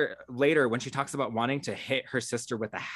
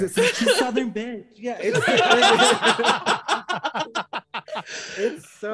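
laughing; 9 semitones higher, 7 dB louder than usual. Real, not acted. Laughing while repeating joke.

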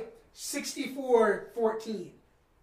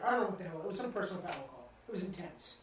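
ordinary delivery

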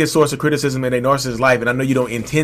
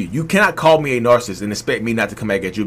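ordinary talk, nothing marked

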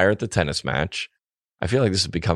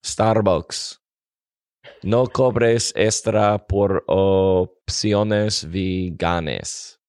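slow, careful Spanish accent; much slower than usual. Shown as a demonstration. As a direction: read the sign slowly in a Spanish accent. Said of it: "I'm gonna do it in the Spanish accent".

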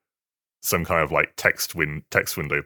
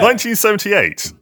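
much higher and louder exclamation; excited; 7 dB louder than usual. Real, not acted. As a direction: shout the year in disbelief. Repeats the answer after "seriously?.

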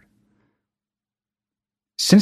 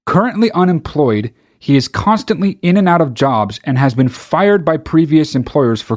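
usual speaking voice

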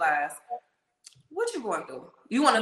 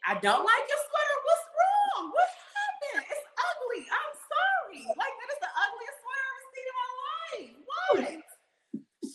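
much higher, fast, half-shouted through laughter; flustered cry of alarm, laughing it off; laughing mock panic. Mock panic at crying customer.

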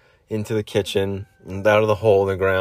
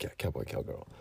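hushed, low confirmation; whispered reply; 7 semitones lower, 17 dB quieter than usual. Acted. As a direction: answer discreetly under your breath. Parents answer the aside.

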